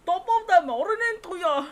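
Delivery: a cheery Irish greeting, hammed up; Irish accent. Streamer voicing an Irish character's greeting, stage-Irish lilt. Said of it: "That's how he sounds".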